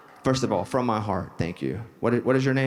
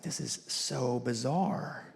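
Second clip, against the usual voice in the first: stunned hush; 7 dB quieter, much slower than usual. Retold or imitated. Stunned meeting his idol.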